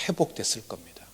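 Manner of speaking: breathy whisper to land the point; hushed preacher's whisper. Real, not acted. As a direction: drop to a whisper for effect. Dramatic hush in sermon narrative.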